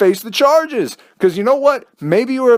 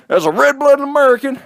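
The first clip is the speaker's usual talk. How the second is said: a light hammed-up Southern American accent; 9 semitones higher, 3 dB louder than usual. Mocking patriots in a light Southern accent. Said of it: "that accent, by the way".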